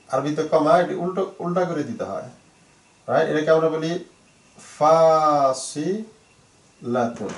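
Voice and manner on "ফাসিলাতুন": very slow, long first vowel and separated syllables. Shown as a demonstration. Teacher introducing the Arabic for comma, slowly syllable by syllable. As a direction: stretch out "ফাসিলাতুন" piece by piece.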